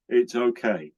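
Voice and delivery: ordinary talk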